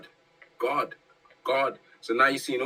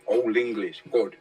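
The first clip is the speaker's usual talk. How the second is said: ordinary talk, nothing marked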